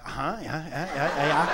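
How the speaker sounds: fake listening. Faking listening.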